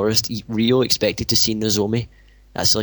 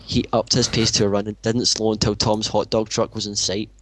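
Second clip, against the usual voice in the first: ordinary talk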